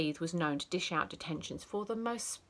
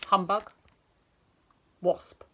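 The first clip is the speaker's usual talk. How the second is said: sour demo; 5 dB louder than usual. Shown as a demonstration.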